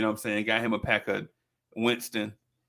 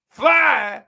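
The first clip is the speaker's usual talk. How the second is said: high mock shout of disbelief; outraged echo; 9 semitones higher, 11 dB louder than usual. Father throws son's boast back at him, high mock-outraged shout. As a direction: shout it back as an incredulous dad.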